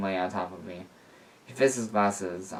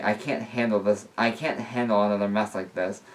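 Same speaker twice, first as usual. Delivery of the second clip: usual speaking voice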